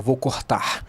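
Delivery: "cortar" with breathy H-like R sounds; R final aspirado. Shown as a demonstration. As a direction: say "cortar" as "cohtahr", both Rs aspirated. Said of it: "Você não diz". ("cortar" com os erres aspirados)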